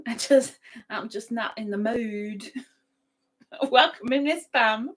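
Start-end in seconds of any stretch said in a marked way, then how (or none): none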